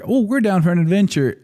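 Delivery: high-pitched, chipper parent impression; eager parents. Parents keen on an Australia trip, imitated eager voice.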